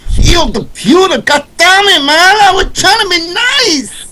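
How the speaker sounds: high, loud angry yell; furious shout. Acted. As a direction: yell it out in outrage. Character furious after being hit.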